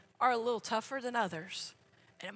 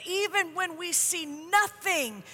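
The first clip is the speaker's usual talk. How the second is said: louder, rising fervent preacher's prayer; 2 semitones higher, 4 dB louder than usual. Acted. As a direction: pray over the room with growing force. Leads a closing prayer.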